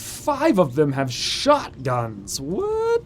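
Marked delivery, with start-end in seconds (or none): 2.4-3.0 sharp high startled "what"; surprised. Real, not acted. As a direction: jolt up in disbelief. Shotguns discovered.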